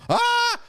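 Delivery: jump-scare yelp to spook the listener; hammed-up fright scream; mock scream. Playful scare after spooky booms.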